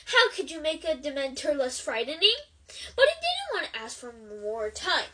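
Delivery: ordinary delivery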